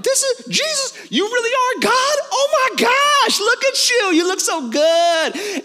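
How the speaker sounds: squealing high, starstruck voice; awestruck disciples. Retold or imitated. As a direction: gush like a starstruck fan, way up high.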